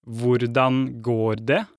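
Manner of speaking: drawn-out, careful teacher repeat; over enunciating. Shown as a demonstration.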